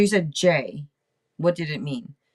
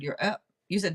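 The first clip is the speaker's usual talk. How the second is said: normal talking voice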